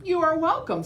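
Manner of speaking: high, bright hen reply; chicken voice. Acted. Edna answering grateful dinosaurs, high chicken voice. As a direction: chirp it proudly.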